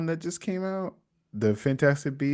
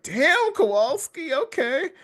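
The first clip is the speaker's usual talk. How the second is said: high, swooning damn okay; impressed; 12 semitones higher, slower than usual. Retold or imitated. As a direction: react like you're smitten. Impressed mock reaction.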